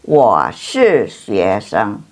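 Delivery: very slow, syllable by syllable; slow model reading. Shown as a demonstration. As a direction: stretch each syllable so students can copy. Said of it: "teacher models Chinese answer".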